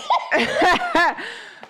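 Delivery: a big high burst of laughter; loud laugh. Real, not acted. Laughing at friend's remark.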